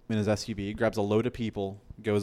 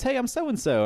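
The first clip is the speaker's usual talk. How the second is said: much higher, chirpy self-introduction; friendly intro. Retold or imitated. The general introducing himself, chirpy high voice.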